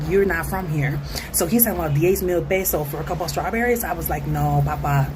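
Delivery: normal talking voice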